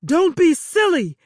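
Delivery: loud, sharp dismissal; barked. Acted. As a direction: bark it at her. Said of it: "Bauer barked".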